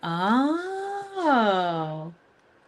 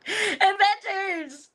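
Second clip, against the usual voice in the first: excited squeal; 12 semitones higher, 5 dB louder, much slower than usual. Real, not acted. Squealing with delight at chat.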